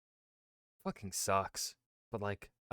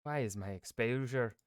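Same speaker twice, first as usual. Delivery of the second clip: ordinary talk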